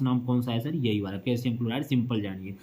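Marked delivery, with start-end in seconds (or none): none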